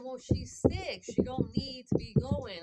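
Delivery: insistent, imitating her own argument; firm retort. Retelling her refusal to ex.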